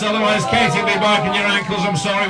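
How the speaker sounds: ordinary talk, nothing marked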